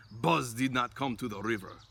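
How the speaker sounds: ordinary delivery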